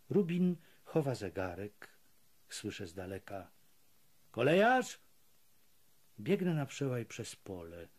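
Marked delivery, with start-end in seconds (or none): 4.3-5.0 warning shout. A narrator's reading. Prisoners warning each other from afar, sharp shout. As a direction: shout it out, higher and louder.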